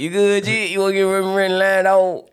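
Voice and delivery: high, loud Florida accent impression. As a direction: put on a thick Florida accent. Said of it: "I hate using my Florida accent".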